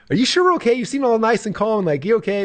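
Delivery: high-pitched, retold worried check-in; anxious questioning. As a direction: act out pressing a too-calm partner for reassurance. Anxiously questioning too-calm partner.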